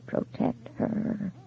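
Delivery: soft, breathy, trembling warning; whispery vibrating voice. A narrator's reading. Ghost warns his widow over the phone, whispery and trembling. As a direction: let the voice tremble and fade.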